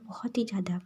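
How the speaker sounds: ordinary delivery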